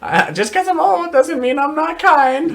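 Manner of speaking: put-on high old man voice. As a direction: plead like a hurt old man. Old necromancer voiced as a reply.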